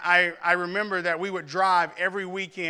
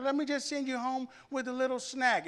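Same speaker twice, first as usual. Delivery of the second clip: higher, softer grandma voice imitating her fussing; sweet old grandma pressing food on him, higher and softer; doting grandma; 7 semitones higher, 5 dB quieter than usual. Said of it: "They go".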